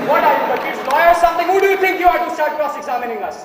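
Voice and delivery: angry shout. Acted. Juror yelling at the dissenter for cross-examining, furious shout. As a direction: blow up at him.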